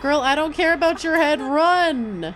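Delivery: loud, high, urgent call to run; urgent yell. Real, not acted. Gamer in a chase yells at character, loud urgent call. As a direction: yell at her to get moving.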